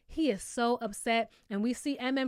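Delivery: normal talking voice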